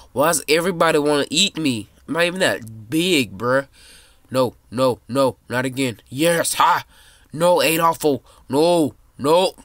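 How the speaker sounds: loud, high protest at the screen; frantic yelling. Real, not acted. Kid gaming, yelling loudly as everyone tries to eat him. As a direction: yell like you're being chased down. Being chased in game.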